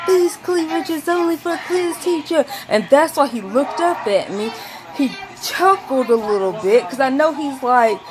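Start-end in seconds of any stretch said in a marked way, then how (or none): 0.1-2.4 retold yell. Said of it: "sitting here screaming".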